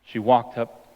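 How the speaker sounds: ordinary talk, nothing marked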